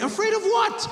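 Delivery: high, incredulous challenge. Acted. Comic challenging the afraid shooter, incredulous. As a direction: throw the question back in disbelief. Comedian mocks the shooter's claim.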